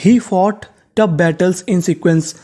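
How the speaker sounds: careful slow sentence; slow model reading. Shown as a demonstration. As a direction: read very slowly, word by word. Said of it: "अब पूरा sentence देखते हैं".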